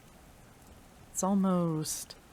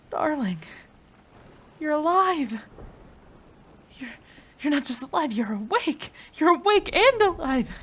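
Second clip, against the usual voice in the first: higher and louder, bursting with joy; overjoyed; 8 semitones higher, 7 dB louder than usual. Acted. Scientist greeting her revived lover, overjoyed and loud. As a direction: greet a loved one back from the dead.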